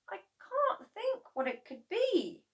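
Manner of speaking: very high perplexed voice; puzzled boy. A narrator's reading. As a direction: wonder aloud, stumped. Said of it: "George said".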